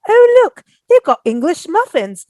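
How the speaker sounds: high, loud put-on British voice; playful fake British exclamation; fake British accent. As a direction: show off the English muffins in a mock English accent. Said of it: "we started speaking British in the accent".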